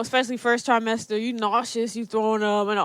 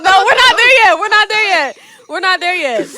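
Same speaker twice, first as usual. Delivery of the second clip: no, we're not there yet, louder and higher, then settling down; insisting; 9 semitones higher, 13 dB louder than usual. Real, not acted. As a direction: stop them with a laughing, insistent no.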